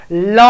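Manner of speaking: ल said alone as a single drawn 'la'; demonstrated L sound. Shown as a demonstration. Teacher has students try saying the letter, demonstrated ल sound. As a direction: sound out ल as an isolated syllable. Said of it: "ल जरा बोल के देखो".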